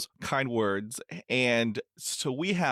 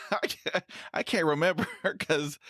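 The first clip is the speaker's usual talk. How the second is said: higher voice broken by laughs; real amused laughter; 7 semitones higher than usual. Real, not acted. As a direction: laugh through the words in recognition. Host laughs at shared experience.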